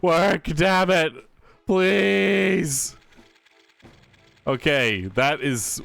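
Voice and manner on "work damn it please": high, loud, desperate begging; pleading with a machine. Real, not acted. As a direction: plead with the program, voice pitched up. Begging the software to work.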